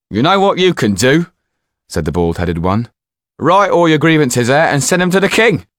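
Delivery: high, loud teasing servant; mocking servant. Bald servant teases Dufour, high mocking voice.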